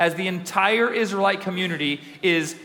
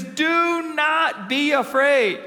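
each word stretched, DO pitched high; drawn-out, high "do not be afraid"; emphatic command; 8 semitones higher, much slower than usual. Retold or imitated. Preacher declaring God's promise to the church, emphatic and drawn-out.

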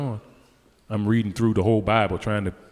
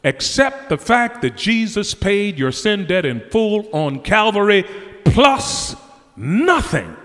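high, ringing preacher's cry; fervent revival preaching; 8 semitones higher, 3 dB louder, slower than usual. Acted. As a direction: drive the gospel home from the pulpit. Preaching at full force.

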